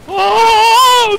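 high, loud burst of shock; startled shout. Real, not acted. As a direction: yell it in sudden fright. Gamer startled in firefight.

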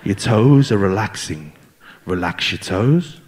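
low, slow mock meditation guide; hammed-up spiritual guide, drawn out; slow soothing guide. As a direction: slowly talk him down into calm. Shaman guiding relaxation slowly.

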